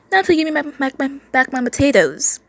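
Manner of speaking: high, loud character voice; mock hissed demand. A narrator's reading. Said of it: "the running state hissed".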